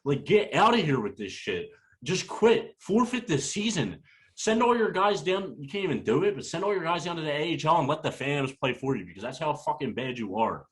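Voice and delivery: furious rant. Real, not acted. Fan ranting on the podcast at his team, furious and high. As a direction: tell the team to quit in disgust. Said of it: "Rant over".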